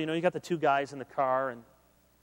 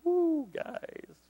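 high squeaky teasing ooh; mock teasing; 12 semitones higher than usual. Mocking a friend's girlfriend, high put-on ooh. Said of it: "your friend's like".

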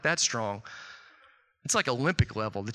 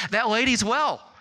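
high-pitched retold good news, emphatic; amazed announcement; 7 semitones higher, 3 dB louder than usual. Retells Christy reporting the good news.

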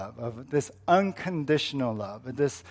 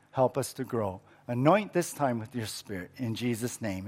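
soft, hushed pastoral prayer; quiet prayer; 2 semitones lower, 4 dB quieter than usual. Acted. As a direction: pray gently over the congregation. Pastor prays before the sermon.

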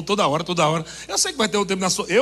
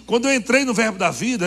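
ordinary talk, nothing marked

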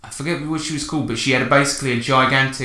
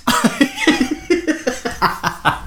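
high burst of laughter. Real, not acted. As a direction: break into giggles. Laughing at own impression.

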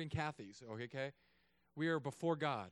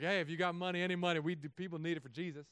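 louder, pleading appeal for money; begging people; 4 dB louder than usual. Retold or imitated. Pastor begging the congregation for money, pleading. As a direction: plead with the crowd to give. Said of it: "just begging everybody".

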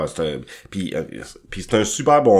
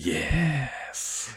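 sensual voice; 4 semitones higher, 7 dB quieter than usual. Acted. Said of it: "on y va sensuel".

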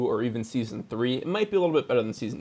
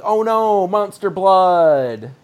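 loud, higher mock dismay, drawn out; hammed-up alarm; 5 semitones higher, 8 dB louder, much slower than usual. Host acts the kid finding monster blood, hammed-up alarm. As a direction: overplay the horror-movie cry. Acting out character seeing the monster.

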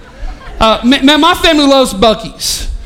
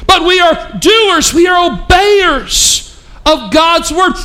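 raised, ringing preacher voice; preaching; 5 semitones higher, slower than usual. Real, not acted. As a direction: preach it out to the whole room. Preaching full force.

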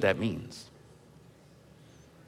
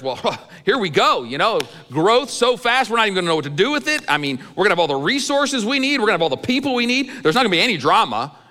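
higher-pitched, eager daydreaming; naive enthusiasm; 5 semitones higher than usual. Acted. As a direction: gush through an imagined run of good fortune. Voicing his naive rosy expectations.

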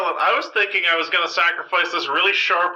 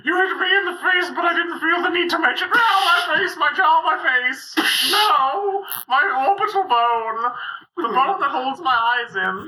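pained shouting; 9 semitones higher than usual. Acted. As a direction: yell like you just got punched. Kaufman brother hit in the face.

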